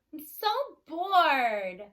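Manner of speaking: long, drawn-out bored sigh of a line; bored whine. A narrator's reading. Young cow to the herd, slowly and boredly. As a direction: drag it out like a bored teen.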